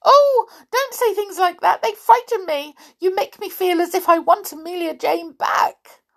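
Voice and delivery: high, squeaky frightened mouse; frightened squeal. A narrator's reading. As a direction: squeak out your fear like a tiny mouse. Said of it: "squeal of fright".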